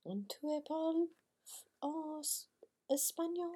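high, questioning schoolroom French; mock polite interruption. Pupils telling teacher she slipped into Spanish, high mock-polite voice. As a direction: ask timidly high, as imitated pupils. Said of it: "we would have to go".

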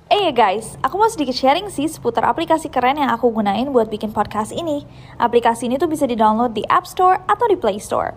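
loud, bright sponsor pitch; upbeat ad read. Acted. Podcaster breaks off the story to promote Anchor, loud cheerful ad read. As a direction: sell the app to the listeners with energy.

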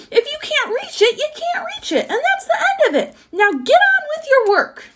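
very high, squawking rant; worked-up high tirade, ending loud; exasperated cry. A narrator's reading. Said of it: "cried the Duke".

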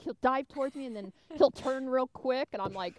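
ordinary talk

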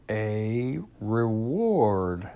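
emphatic and drawn out, volume held back; very loud. A narrator's reading. Owl correcting Pooh, insistent, mildly loud. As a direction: stretch it out slowly, insisting. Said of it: "said Owl very loudly".

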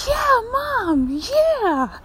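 high squealed cheer for mom; mock cheer. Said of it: "I'm like".

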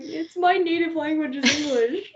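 high-pitched retold objection, near laughter; mock-exasperated insistence; incredulous protest. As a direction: pitch up in amused disbelief. Retold protest about native language.

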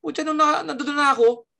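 normal talking voice

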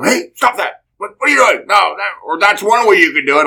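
loud, high mock-sarcastic scolding; mock sarcastic instructor shouting. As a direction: snap at the student with sarcasm. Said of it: "all sarcastic".

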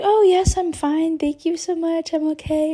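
high, chirpy retold reassurance; polite brush-off. Fallen skier answering ski patrol, politely reassuring.

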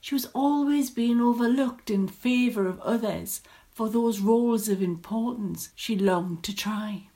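ordinary delivery, nothing marked

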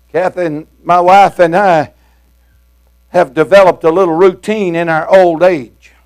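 normal talking voice

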